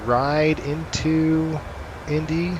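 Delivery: usual speaking voice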